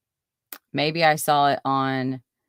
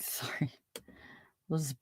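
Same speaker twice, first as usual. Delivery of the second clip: whispered sorry; 14 dB quieter than usual. Real, not acted. Laughing apology to chat, whispered.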